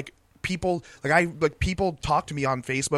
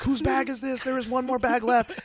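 mock calling out; 11 semitones higher than usual. Airport worker calling out bags.

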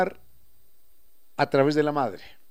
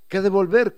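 "que a devolver" higher and louder, rising at the end on "devolver"; "que a devolver" with the Quichua-style lift; highland Quichua-style intonation; 5 semitones higher, 9 dB louder than usual. Shown as a demonstration. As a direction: Andean sing-song on "que a devolver", ending up. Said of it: "y la entonación es".

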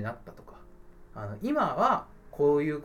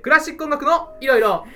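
high, loud title call; corner title call; 9 semitones higher, 9 dB louder than usual. Acted. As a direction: shout the segment name with gusto. Title call repeated with listeners.

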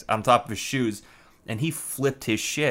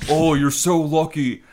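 mock roommate voice; 3 semitones higher than usual. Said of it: "he was like".

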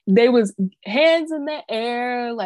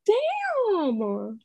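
high, long drawn-out "Damn"; lightly hammed disbelieving damn; 10 semitones higher, 4 dB quieter than usual. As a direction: stretch it in disbelief. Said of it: "I was just like".

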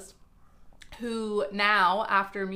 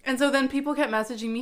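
usual speaking voice

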